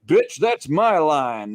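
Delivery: Nick Fury. Retold or imitated. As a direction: object slowly and loudly, imitating a character. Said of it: "And Nick Fury's like".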